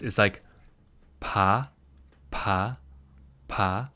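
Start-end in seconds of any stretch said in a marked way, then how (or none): 1.2-3.9 pa said soft and loose, quieter; pa with a lax, breathy start. Shown as a demonstration. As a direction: let pa fall out relaxed. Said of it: "This one's, like, very loose".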